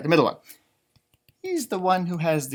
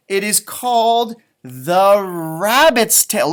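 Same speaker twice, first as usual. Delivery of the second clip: title announcement; 2 semitones higher, 7 dB louder, slower than usual. Acted. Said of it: "what is the book we're gonna read".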